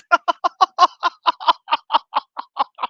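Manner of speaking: high, loud staccato cackle; long helpless laugh. Real, not acted. Reactor falls apart over the bleachers bar, helpless laughter.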